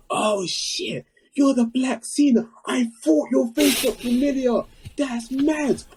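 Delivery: very high, excited fan shriek; mock scream. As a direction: shriek like a starstruck wrestling fan. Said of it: "He screamed".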